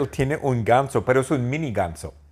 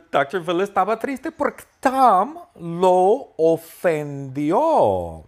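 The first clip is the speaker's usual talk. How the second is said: higher, slow, louder weepy telling; mock-tearful narration; 9 semitones higher, 4 dB louder, slower than usual. Narrator mock-crying over offended doctor Phil, slow high weep. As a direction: tell it through fake tears, slowly.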